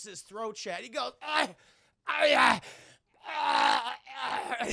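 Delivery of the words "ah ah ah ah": high, loud choking cries; hammed-up death throes, cry after cry; mock agonized wails, petering out; dying cries. Robber's throat slashed, acted dying.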